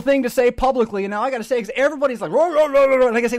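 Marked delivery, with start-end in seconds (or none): 2.3-3.1 mock roaring. Everybody's outrage mocked.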